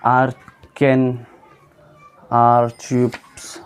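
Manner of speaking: first slow try at आर्चिप्स; slow careful reading. Shown as a demonstration. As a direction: sound out आर्चिप्स slowly, syllable by syllable.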